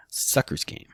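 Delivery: breathy whispered jab; whispered aside. Real, not acted. Host whispering to viewers that renting is a sucker's game. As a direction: hiss it as a throwaway aside.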